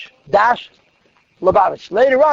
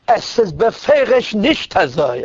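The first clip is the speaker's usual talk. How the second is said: each word laid down slowly and firmly; a rigid old chassid insisting it is not so; slow emphatic Yiddish; 3 semitones higher, much slower than usual. Retold or imitated. Old chassid to the Rebbe, slow and firm. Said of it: "That's how he spoke".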